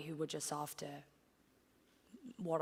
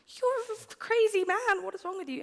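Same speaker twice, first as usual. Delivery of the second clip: much higher, loud, disbelieving cry; retold outburst; 13 semitones higher, 9 dB louder than usual. Woman retelling calling her guru crazy, high and loud. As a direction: shriek it at him as if he's mad. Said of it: "And I was like".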